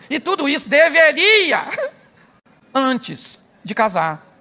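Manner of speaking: slow, drawn-out antes de casar; stress on antes. Real, not acted. Preacher warning couples, drawing out antes. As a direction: lean hard on antes, slowly.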